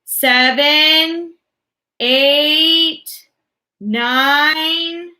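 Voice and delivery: high, loud count. A narrator's reading. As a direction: keep counting threateningly. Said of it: "said the voice".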